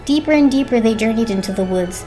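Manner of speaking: ordinary delivery, nothing marked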